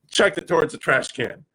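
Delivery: ordinary talk, nothing marked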